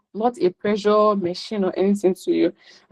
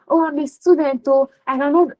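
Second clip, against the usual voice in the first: higher, whining put-on excuse voice; mock whiny excuse; 6 semitones higher than usual. Speaker mocking students who won't start, whiny mock voice. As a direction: mimic a lazy student making excuses.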